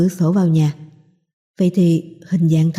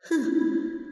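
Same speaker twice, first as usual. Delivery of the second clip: eerie ghost laugh; 8 semitones higher, 9 dB quieter than usual. A narrator's reading.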